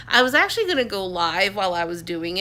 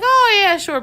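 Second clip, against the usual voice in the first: higher, louder laughing "Oh, yeah, sure"; 12 semitones higher, 9 dB louder than usual. Retold or imitated. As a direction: brush off the compliment with a laugh. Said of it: "And I just kinda laughed".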